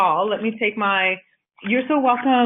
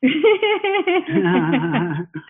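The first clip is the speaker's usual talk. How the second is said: high laugh; 8 semitones higher than usual. Real, not acted. Laughing at sexy voice joke.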